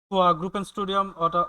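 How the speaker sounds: spoken very slowly, word by word; slow German example. Shown as a demonstration. Teacher reading a German phrase slowly to students. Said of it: "teaching German phrase".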